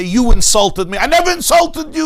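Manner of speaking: loud, high indignant outburst; a spouse loudly denying an insult; quarrel. Acted. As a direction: shout it back as a hurt spouse. Acted couple's quarrel.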